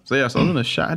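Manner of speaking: ordinary talk, nothing marked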